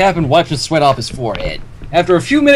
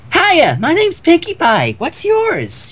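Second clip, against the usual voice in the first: high, slow, bubbly greeting; excited Pinkie Pie; 12 semitones higher, much slower than usual. Acted. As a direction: bounce with glee as you say it. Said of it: "she yelled out excitedly".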